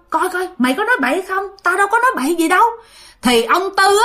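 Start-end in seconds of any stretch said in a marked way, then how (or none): 0.1-1.4 higher, pressing question to a neighbour; nervous villagers question each other, pitched high; excited villager. Retold or imitated. Dân làng xôn xao hỏi nhau. 1.6-2.6 high and fast defensive denial; quick denial. Retold or imitated. Dân làng chối, xôn xao.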